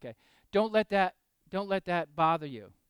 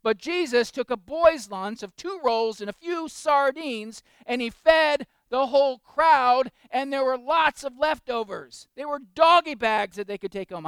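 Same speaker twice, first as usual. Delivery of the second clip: louder, slow preacher's build; preaching at full force; 8 semitones higher, 12 dB louder, slower than usual. Acted. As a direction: proclaim it to the back pews. Preaching to congregation.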